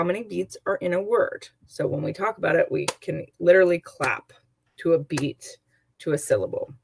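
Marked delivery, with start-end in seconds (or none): none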